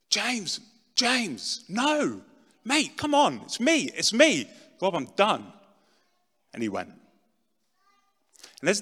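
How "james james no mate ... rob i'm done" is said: high, desperate pleading to a friend leaving; begging. Retold or imitated. Begging his last friend James not to leave, high and desperate. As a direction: plead as he walks out the door.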